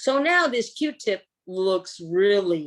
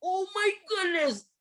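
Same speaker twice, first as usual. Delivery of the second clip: put-on disgusted kids; 9 semitones higher, 4 dB quieter than usual. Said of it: "they go".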